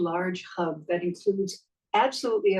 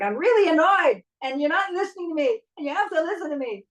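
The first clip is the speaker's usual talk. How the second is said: much higher, louder annoyed whine, rushing at the end; 9 semitones higher, 4 dB louder than usual. Shown as a demonstration. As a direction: complain like no one has been listening. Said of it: "then that's what you're gonna sound like".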